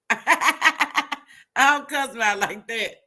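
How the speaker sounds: slow, laughing through the words; can't stop laughing while saying it. Real, not acted. Laughing about cussing his kids out.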